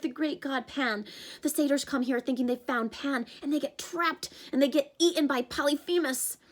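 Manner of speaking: put-on a panicky young satyr; Grover's voice. Grover explains the trap.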